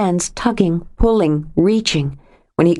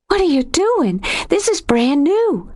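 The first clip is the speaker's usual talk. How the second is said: shocked; 11 semitones higher than usual. A narrator's reading. Lexi shocked, skirt soaked.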